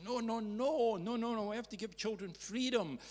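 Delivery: high, insistent mock protest; a hint of a protesting audience member; objecting audience. Audience members pushing back on the speaker, mock insistent. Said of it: "other folk in the audience were saying".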